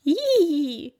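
high, loud spluttered "Ih"; sputtering squeal. A narrator's reading. Lenny hit by the spray, spluttering high. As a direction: splutter as the water hits. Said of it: "prustet Lenny".

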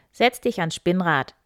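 stern king barking an order; commanding king. Acted. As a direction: order her to the wheel. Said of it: "befahl er".

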